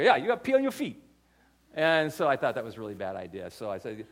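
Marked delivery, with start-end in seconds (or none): 0.0-1.0 higher, louder laid-back local voice; imitating the Hawaiian guys giving matter-of-fact advice; pidgin-tinged island bro voice. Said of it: "these Hawaiian guys".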